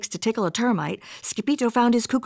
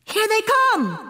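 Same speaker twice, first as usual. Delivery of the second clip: high, stretched warning yell; hollered; 13 semitones higher, 3 dB louder than usual. A narrator's reading. Skipito warning of approaching visitors, hollering. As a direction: yell the warning, holding the last word. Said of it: "hollered Skipito".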